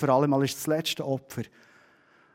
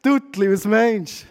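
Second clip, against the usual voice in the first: ungläubiges Staunen; 5 semitones higher, 5 dB louder than usual. Retold or imitated. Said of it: "Und zu dieser Zeit habe ich gedacht".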